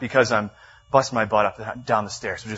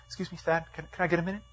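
higher, much softer boss voice; hesitant manager; 6 semitones higher, 11 dB quieter, faster than usual. Retold or imitated. Manager asks employee for a word, softly and hesitantly. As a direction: lightly voice a tentative manager.

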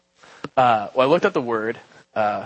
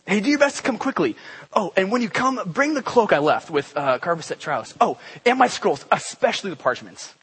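breezy, much higher, chatty like a quick note; casual letter reading; 6 semitones higher than usual. Shown as a demonstration. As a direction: read it like a friend dashing off a note. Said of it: "this is how I read it".

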